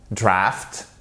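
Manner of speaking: "Draft" with a short vowel, ending in f-t; "Draft" pronunciation. Shown as a demonstration. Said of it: "mais il faut pas confondre les deux".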